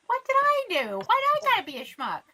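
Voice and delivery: high, fast indignant whine; mock indignant whine. Child scolded by mom, high indignant whine. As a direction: squeak it out, wounded and baffled. Reacting to mom calling schmuck.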